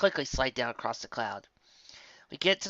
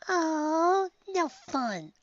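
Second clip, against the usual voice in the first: put-on high, sulky child's grumble; grumbling; 3 dB louder, much slower than usual. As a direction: draw out the pout in a kid voice. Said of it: "I grumble".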